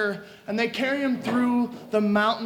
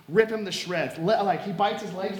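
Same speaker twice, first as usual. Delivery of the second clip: ordinary talk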